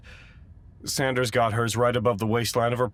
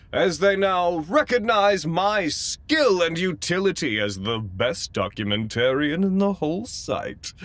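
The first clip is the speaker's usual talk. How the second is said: mock boastful; 10 semitones higher, 6 dB louder than usual. Narrator boasting about own skill.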